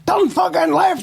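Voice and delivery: cop's mock shout, pitched well up; escalated police shout. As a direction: yell it again, losing patience with the prisoner. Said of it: "He went".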